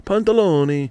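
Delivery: mock Italian. Comic showing Spanish and Italian sound alike, "pantaloni" in mock Italian. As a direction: say "pantaloni" like an Italian word, bouncing up. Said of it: "a little bit like Italian and Spanish".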